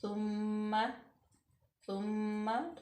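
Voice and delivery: सुम्मा with the doubled meem hummed through the nose; nasal ghunna. Shown as a demonstration. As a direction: hold the mm of सुम्मा. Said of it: "यहाँ पे गुन्ना पैदा होगा".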